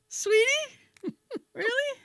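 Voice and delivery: high-pitched mock disbelief; incredulous. Incredulous echo of the nurse.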